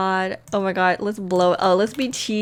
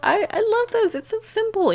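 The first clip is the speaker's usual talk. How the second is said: delighted; 12 semitones higher than usual. Real, not acted. Delighted at the simple romance.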